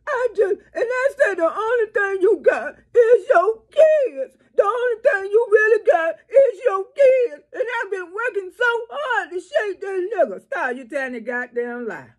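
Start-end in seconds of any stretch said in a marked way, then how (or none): 0.1-4.4 high, wailing mock sob over the words; sobbing parody of a tearful mother; mock crying. 4.5-7.4 high, hammed-up wailing sob; crying parody, voice pitched high; mock crying. Mocking a crying mother. 7.5-10.4 high mock sob, a little softer; mock crying. Mocking a crying mother.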